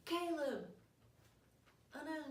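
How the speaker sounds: high, soft half-hearted lament; imitated grief, understated; mock dismay. Family reacts to boy's death.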